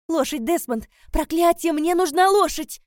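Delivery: shouted urgent demand, high and loud; desperate shout. Acted. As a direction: yell frantically for help at once.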